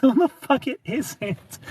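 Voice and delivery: high, drawn-out comic objection; slow, indignant contradiction, laughter around it; retold arguing. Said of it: "arguing with my ex-girlfriend".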